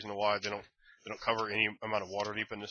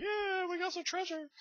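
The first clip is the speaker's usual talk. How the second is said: much higher, softer squeaky little voice; squeaky kobold; 6 dB quieter than usual. Retold or imitated. Kobolds boasting of their treasure, squeaky higher voice. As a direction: make it tiny and squeaky. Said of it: "and they're like".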